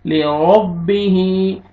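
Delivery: लिरब्बिही with ba doubled and joined; correct reading. Shown as a demonstration. Teacher modelling the correct reading. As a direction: stretch लिरब्बिही slowly, ba held. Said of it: "बा के साथ मिलाना है".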